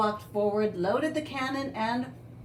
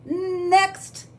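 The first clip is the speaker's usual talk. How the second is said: loud, high parrot call; drawn-out bird-like summons; blaring; 7 semitones higher, 6 dB louder than usual. A narrator's reading. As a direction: blare the order like a parrot. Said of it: "blared the parrot".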